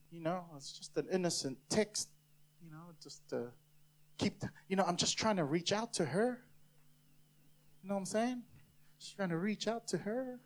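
hushed, innocent-sounding justification; sheepish excuse. Acted. Person excusing an innocent text, hushed and sheepish. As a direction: play it down softly, as if nothing's going on.

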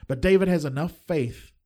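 usual speaking voice

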